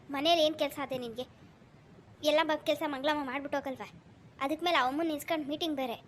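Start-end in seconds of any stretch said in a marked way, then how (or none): none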